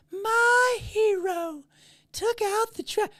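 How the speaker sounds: very high, slow swooning falsetto; hammed-up adoring squeal, stretched out; mock gushing. Woman fawning over a man taking out the trash, mock gushing. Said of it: "the woman gushes and she's like".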